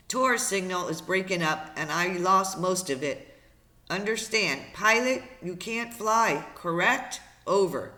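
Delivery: radio operator. Acted. Operator checks with boy over breaking signal, emphatic radio call. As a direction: project each phrase through heavy static.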